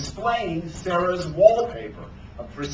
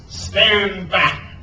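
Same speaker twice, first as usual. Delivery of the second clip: pitched-up captain's bellow; bellowed command; 4 semitones higher, 4 dB louder than usual. A narrator's reading. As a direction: bellow to clear the crowd. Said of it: "bellowed Hook".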